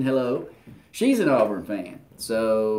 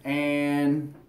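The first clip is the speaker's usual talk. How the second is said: ordinary talk, nothing marked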